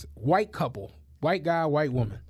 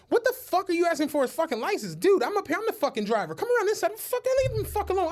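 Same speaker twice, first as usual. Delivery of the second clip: mock angry friend; 9 semitones higher, faster than usual. Friend yelling at cop in story.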